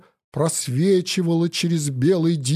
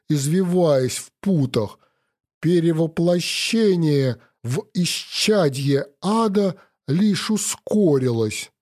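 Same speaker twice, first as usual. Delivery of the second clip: ordinary delivery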